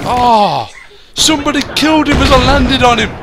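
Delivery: loud indignant yell; outraged shout. Real, not acted. Outraged at stolen kill.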